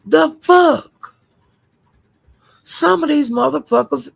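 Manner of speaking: sharp high incredulous outburst; reacting to a wild claim, high and shocked; stunned disbelief. Real, not acted.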